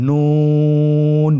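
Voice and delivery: নুন stretched very long, thin and loud; drawn-out letter name. Shown as a demonstration. Teacher introducing the next letter, long drawn-out নুন. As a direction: hold নুন for three counts. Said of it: "আরেকবার চেষ্টা করুন".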